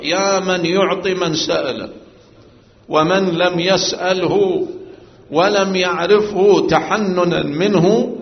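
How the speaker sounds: slow rising plea to God; fervent supplication. Acted. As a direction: draw out each word in devotion.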